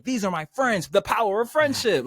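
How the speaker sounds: hammed-up anime hero earnestness; typical friendship speech. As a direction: send up the corny friendship cliché. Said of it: "it wasn't, like, the typical".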